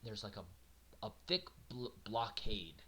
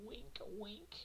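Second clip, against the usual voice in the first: sarcastic wink wink; 10 semitones higher, 8 dB quieter than usual. Pretend.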